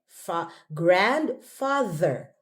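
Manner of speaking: "grandfather" very slow, voiced th with tongue showing; slow th demo. Shown as a demonstration. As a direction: break it up then say "grandfather" with a long th. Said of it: "Put out your tongue".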